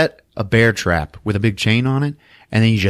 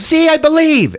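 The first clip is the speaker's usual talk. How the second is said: high, loud and insistent; mock triumphant retort; 5 dB louder than usual. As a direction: throw it back high and loud, acting.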